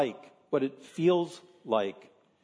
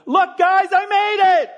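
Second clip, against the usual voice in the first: much higher, much louder victory cry; triumphant shout; 12 dB louder than usual. Pretend. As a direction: burst in shouting that you made it.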